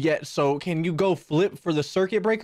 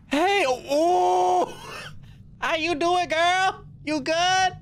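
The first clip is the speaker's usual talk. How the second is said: high-pitched startled hi to the stranger; startled greeting; 11 semitones higher, much slower than usual. Real, not acted. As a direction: yelp then laugh it off. Startled by NPC woman.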